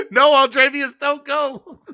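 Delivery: high, loud laughing call; laughing plea. Real, not acted. Streamer begs departing viewer to stay, laughing loudly.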